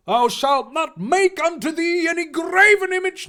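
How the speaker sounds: high, loud mock-sermon reading; preacher voice. Reader attempting Exodus as a preacher, proclaiming. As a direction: proclaim the commandment from the pulpit. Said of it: "preacher voice".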